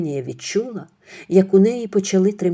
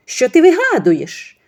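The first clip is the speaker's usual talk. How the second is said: high, loud, slow denial; defensive mother-in-law; 9 semitones higher, 7 dB louder than usual. Acted. Mother-in-law indignantly denying to Ksenia. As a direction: deny it indignantly.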